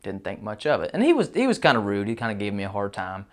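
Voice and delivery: usual speaking voice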